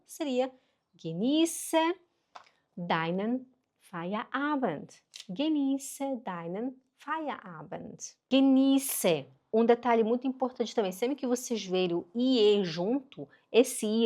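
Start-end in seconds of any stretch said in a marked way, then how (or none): 0.9-8.2 "Genieße deinen Feierabend" very slowly, spaced out; careful German with long "ie"; slow model phrase. Shown as a demonstration. Teacher models phrase four for learners, slow and segmented. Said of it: "Vamos para a frase quatro". 8.3-9.3 "Genieße" higher, the ie held long. Shown as a demonstration. Teacher shows learners the detail, long-vowel "Genieße". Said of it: "ele é longo".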